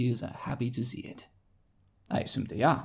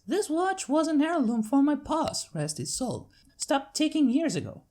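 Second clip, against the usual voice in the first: higher country mare, grateful; Applejack voice; 14 semitones higher than usual. Acted. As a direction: speak of pa's heirloom with thanks. Applejack voiced over many lines.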